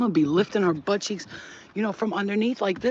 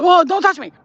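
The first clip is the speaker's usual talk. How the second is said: much higher, louder yelp; overwhelmed yelp; 11 semitones higher, 12 dB louder than usual. Retold or imitated. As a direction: recoil from a touch. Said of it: "Like, I can't".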